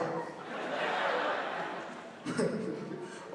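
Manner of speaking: breathy soft chuckle; quiet amused laugh. Real, not acted. Comedian laughing at his own joke, a soft chuckle.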